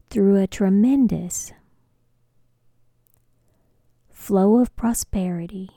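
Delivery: slow, phrase by phrase with long pauses; slow dictation. Shown as a demonstration. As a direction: dictate it slowly for someone writing it down. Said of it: "I'll say it slower so you can write it down".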